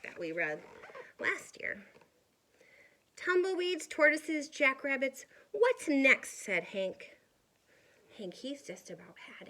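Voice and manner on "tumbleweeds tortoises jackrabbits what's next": loud, higher exasperated list; fed up with every visitor. Acted. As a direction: cactus at the end of his rope. Exasperated cactus voiced.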